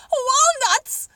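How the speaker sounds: high, loud screech of joy; scream. Acted. Monkey hearing about walnuts, screaming with joy. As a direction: shriek it out in delight. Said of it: "screamed the monkey".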